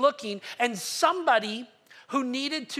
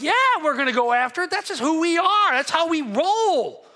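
loud, proud shepherd voice, lines rising higher; eager shepherds; 4 semitones higher, 7 dB louder than usual. Retold or imitated. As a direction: proclaim it loudly like proud villagers. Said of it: "they're like".

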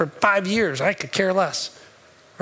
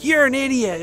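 road rage; 6 semitones higher, 4 dB louder than usual. Retold or imitated. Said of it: "the mom's like".

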